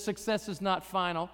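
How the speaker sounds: ordinary talk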